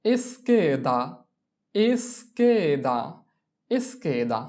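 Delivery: এসকেদা slowly stretched, es-KE-da; slow drill of এসকেদা; Italian pronunciation. Shown as a demonstration. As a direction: drill এসকেদা, the middle take drawn out. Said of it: "এস এর উচ্চারণ হবে এস".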